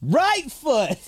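high called-out cue; excited call. Real, not acted. Player calling out the next dance step, high excited cry. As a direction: shout the move out.

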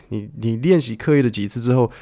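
usual speaking voice

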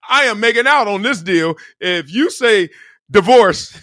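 gleeful divorced husband. Retold or imitated. Husband bragging to the judge, gleeful and loud.

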